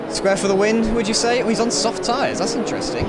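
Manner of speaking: high, loud and excited. Real, not acted. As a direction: lift the voice up with excitement. Excited commentary on the race start.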